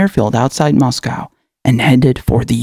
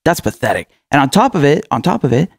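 ordinary talk, nothing marked

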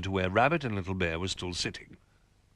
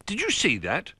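higher, eager call; excited cry; 9 semitones higher than usual. Acted. Rabbit to Little Bear after the bump, excited cry. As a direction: Rabbit can't believe what just happened. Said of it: "cried Rabbit".

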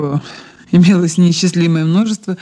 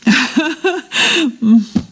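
a short, slightly higher laugh; embarrassed little laugh; 7 semitones higher than usual. Acted. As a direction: laugh off the confession. Admits trying on earrings. (смущённый смешок)

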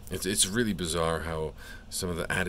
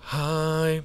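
high giddy squeaky laugh; breathless delighted giggle; nervous laugh; 10 semitones higher, 4 dB louder than usual. Real, not acted. Greeting card, giddy.

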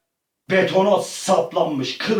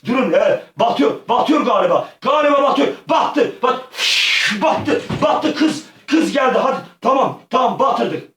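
excited shouting; 8 semitones higher, 4 dB louder than usual. Real, not acted. Boat sinking excitement.

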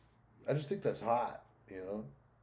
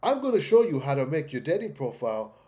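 loud, raised put-on European accent; mock European accent; 4 semitones higher, 5 dB louder than usual. Streamer parodies a dating coach's promise, mock European accent. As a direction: parody the video host's European accent, pitch climbing. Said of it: "Why do you gotta talk like that".

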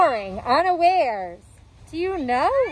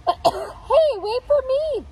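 high pleading call; crying out; 10 semitones higher, slower than usual. A narrator's reading. Ketchup calling to the others, high pleading call. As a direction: call out high, left behind. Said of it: "the ketchup cried".

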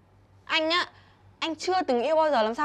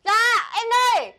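high, louder, slow sugary answer; sweet reply; 6 semitones higher, 6 dB louder, slower than usual. Acted. The sister answers her caller sweetly, high and slow. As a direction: sing out a sweet, eager yes.

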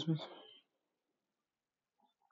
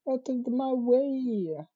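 high and slow, brushing past; drawn out, high-pitched push through; high slow shove; 8 semitones higher, slower than usual. Acted. Pushing past the crowd, high and slow.

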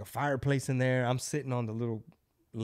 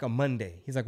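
ordinary talk, nothing marked

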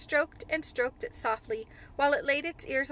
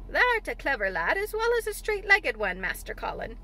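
lively dialect voice, swinging high then dropping; Ben's chuckling admiration; 4 semitones higher, 4 dB louder than usual. Acted. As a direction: praise the boy in a rustic old gardener's voice. Voices old gardener in dialect.

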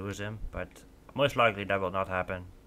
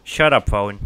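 irritated snap; 11 dB louder, slower than usual. Real, not acted. Snapping at a ringing phone.

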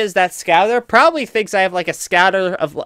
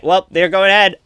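normal talking voice

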